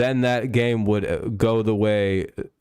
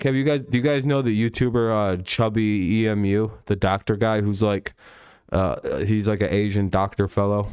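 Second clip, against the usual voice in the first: normal talking voice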